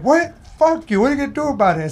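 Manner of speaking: high, sharp mock confrontation; retold angry challenge. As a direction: snap it back like picking a fight.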